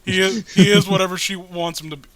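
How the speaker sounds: ordinary talk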